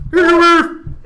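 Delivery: high, loud bellow of the name; shouted surname. Pretend. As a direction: holler it like a war cry. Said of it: "that's exactly how everyone's calling me".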